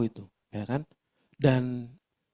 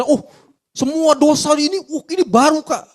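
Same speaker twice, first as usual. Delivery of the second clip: much higher, louder mock naive voice; naive churchgoer; 10 semitones higher, 7 dB louder than usual. Preacher mimics a naive believer, much higher and louder. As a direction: play the gullible believer, wide-eyed.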